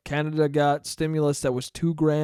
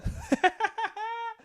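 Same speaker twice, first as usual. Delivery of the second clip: quick high giggle; high laugh; 6 dB quieter than usual. Real, not acted. Laughing at his own age joke. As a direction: let a high laugh slip out.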